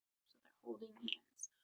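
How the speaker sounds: soft whisper aside. Real, not acted.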